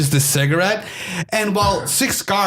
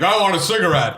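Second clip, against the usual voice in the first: slightly raised, lightly acted demand; prisoner's demand; 4 semitones higher than usual. Retelling Cage's first request to the guard, mild demand.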